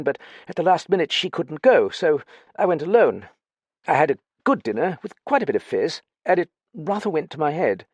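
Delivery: high-pitched, hesitant upper-class chap; flustered young gentleman, reluctant. Acted. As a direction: confess haltingly in a high, fussy voice. Narrator voices Dallings telling his story.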